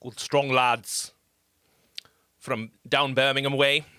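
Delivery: streamer gamely attempting a Brummy accent for a viewer from Birmingham; attempted Brummy accent. Chat greeting in a self-mocking Birmingham accent. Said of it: "I can't do a Brummy".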